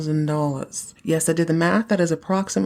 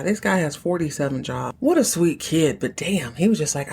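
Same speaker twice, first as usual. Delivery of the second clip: usual speaking voice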